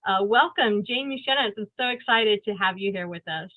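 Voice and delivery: ordinary talk, nothing marked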